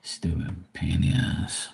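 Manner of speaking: ordinary talk, nothing marked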